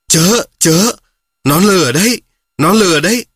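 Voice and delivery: high, loud urgent warning call; peacock's urgent warning. A narrator's reading. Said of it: "cất tiếng bảo cút". (chim công cao giọng, to tiếng cảnh báo gấp)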